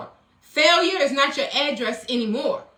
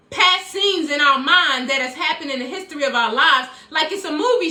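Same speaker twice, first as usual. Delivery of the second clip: usual speaking voice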